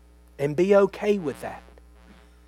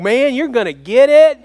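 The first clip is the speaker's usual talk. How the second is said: put-on louder taunting kids' voice; teasing siblings; 9 semitones higher, 11 dB louder than usual. Other kids in the corner taunting, mock sing-song tease. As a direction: tease like siblings watching someone get in trouble. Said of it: "The ones over here in the corner going".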